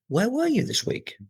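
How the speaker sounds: "Where were you" lifted very high in pitch; exaggerated high swoop up on "were you", dropping after. Shown as a demonstration. Teacher showing students question intonation, pitch pushed up. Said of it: "your intonation should go up".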